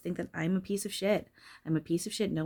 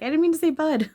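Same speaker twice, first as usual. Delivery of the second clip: high, loud, embarrassed backpedal; flustered protest; 11 semitones higher, 9 dB louder, faster than usual. Retold or imitated. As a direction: squeak out a hasty denial. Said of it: "And I was like".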